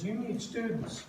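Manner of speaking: lightly mock-annoyed retort; mildly annoyed wife. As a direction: playfully mimic a wife who's had enough. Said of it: "she gets annoyed, and she says".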